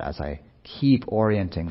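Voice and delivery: usual speaking voice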